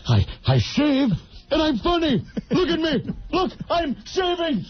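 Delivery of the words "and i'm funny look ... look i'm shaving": high, mock-manic clowning; comedy-role Robin Williams. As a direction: beg for attention like a zany comic. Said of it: "And the comedy roles".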